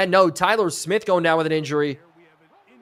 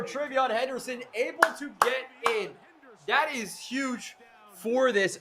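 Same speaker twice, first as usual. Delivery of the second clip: high but softer, drawn-out call; thrilled touchdown call; 6 semitones higher, 7 dB quieter than usual. Real, not acted. Streamer savoring Henderson getting in, thrilled. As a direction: stay high as the volume drops away, savoring it.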